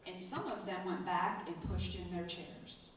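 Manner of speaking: usual speaking voice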